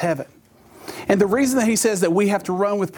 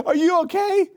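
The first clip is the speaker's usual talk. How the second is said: wife, concerned; 12 semitones higher than usual. Retold or imitated. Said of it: "she's like".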